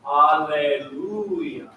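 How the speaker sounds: slow Alleluia, pushing on LU; drawn-out Alleluia with a swell on the third syllable; push and pull. Shown as a demonstration. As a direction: stretch and stress the LU in Alleluia, then let go. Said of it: "the push and pull".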